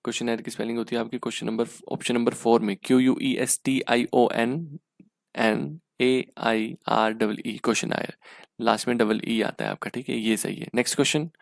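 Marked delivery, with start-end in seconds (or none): 2.8-7.6 letters spaced out slowly one by one; dictating the letters to students; spelled out slowly. Shown as a demonstration. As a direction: spell each letter with care. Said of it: "spelling the word letter by letter".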